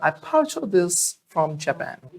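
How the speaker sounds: slow, showy boast; grandly stylish model line; in style. Shown as a demonstration. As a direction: show off the purchase slowly. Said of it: "style में बोलो मधु madam".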